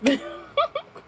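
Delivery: sudden high cackle of amusement; high shrieking laugh. Real, not acted. Joking about Leo season, high shrieking laugh. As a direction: let out a shrill burst of laughter. Laughing at her own joke.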